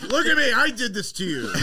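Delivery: loud, high mock villain gloat. Friend joking as the gloating attacker, loud and high. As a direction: crow it at your victim, over the top.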